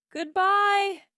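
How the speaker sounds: high, loud farewell call; shouting goodbye. A narrator's reading. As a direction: call it after someone leaving. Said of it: "his mother shouted".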